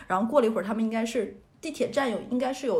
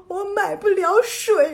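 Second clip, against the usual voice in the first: much higher, louder, slow mock sob; crying wail; 9 semitones higher, 8 dB louder, slower than usual. Telling the subway worker she can't buy water, a loud mock wail. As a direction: wail it like a helpless child, half joking. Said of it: "然后就继续哭".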